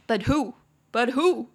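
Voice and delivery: loud, punchy "But who?"; emphatic challenge. Acted. Teacher challenging those who would leave it to others, loud and emphatic. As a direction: hit WHO hard in "But who?".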